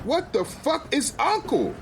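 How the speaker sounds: high-pitched alarmed disbelief; stunned, high-voiced question; shocked outburst. Husband spots wife with man.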